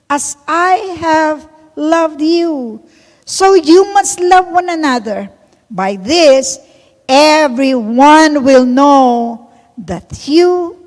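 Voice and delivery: loud, slow, solemn verse reading; scripture reading. Real, not acted. Pastor reading John to the church, slow and loud. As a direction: proclaim the verse slowly.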